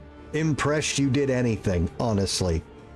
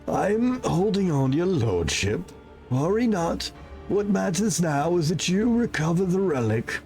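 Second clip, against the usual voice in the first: high, earnest servant voice; dying servant; 6 semitones higher than usual. Acted. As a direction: loyal servant with last orders.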